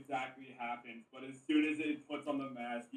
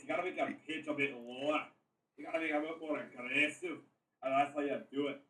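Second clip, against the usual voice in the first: Scottish accent. Shown as a demonstration. Player shows friends how Scottish differs from Irish, Scottish accent. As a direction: demonstrate an assertive Scottish accent. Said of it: "Scottish sounds more like this".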